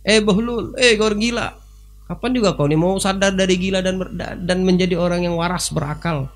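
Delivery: high teasing voice; mocking king. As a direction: taunt the madman from above. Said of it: "Yang mulai Harun Ar-Rasyid ini". (raja mengejek dengan suara tinggi)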